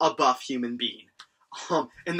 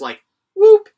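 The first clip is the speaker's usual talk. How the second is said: high, loud rising whoop sound; 16 dB louder than usual. Retold or imitated. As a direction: whoop up like a cartoon sun. Sun popping up animation.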